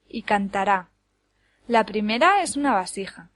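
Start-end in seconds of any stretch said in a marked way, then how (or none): 0.2-0.9 cantará with the beat on the end, can-ta-RÁ; stress on last syllable. Shown as a demonstration. Teacher contrasts words by accent, cantará stressed on RÁ. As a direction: land on the final RÁ of cantará. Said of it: "cambiar el acento de lugar".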